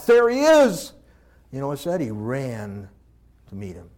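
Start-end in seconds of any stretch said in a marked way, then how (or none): 0.1-0.9 high, loud, exultant; joyful cry. Acted. The father crying out as his son comes home, high and exultant. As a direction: shout it with overflowing joy.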